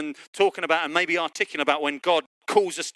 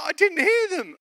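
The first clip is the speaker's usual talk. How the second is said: high-pitched imitation of a flustered excuse; mock defensive wail; 14 semitones higher than usual. Wife excusing herself to husband, mock defensive. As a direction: protest it high, hammed-up.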